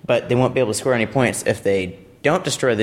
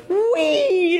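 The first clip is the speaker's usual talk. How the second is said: very high, drawn-out squeal of flight; gleeful "whee". Retold or imitated. Noodle flies out from under robot, high "whee". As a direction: imitate something shooting off with a long high wheee. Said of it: "the noodle just goes".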